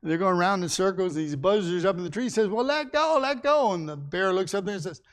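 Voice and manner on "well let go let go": high cartoon buzzard voices. Buzzards up in the tree call down to the bear, high and teasing. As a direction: squawk it much higher, a little louder.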